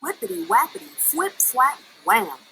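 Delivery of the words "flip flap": ordinary delivery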